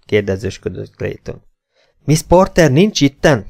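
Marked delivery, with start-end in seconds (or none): 2.0-3.5 raised, high-pitched outcry; exclaimed cry. A narrator's reading. Esmeralda answering Clayton, crying out. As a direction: cry out the alarming news. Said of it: "kiáltotta Esmeralda".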